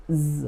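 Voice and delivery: জ as a soft, held "zzz"; voiced z sound. Shown as a demonstration. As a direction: hum জ like English z. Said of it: "এস এর sound মাঝে মাঝে".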